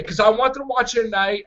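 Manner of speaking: normal talking voice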